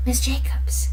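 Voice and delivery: breathy whisper of her name. Retold or imitated. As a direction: whisper to catch her attention from outside. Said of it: "and he's like".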